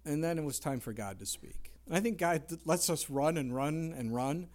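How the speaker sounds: ordinary delivery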